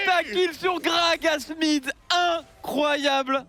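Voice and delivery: excited. Acted. Co-caster savours the pentakill.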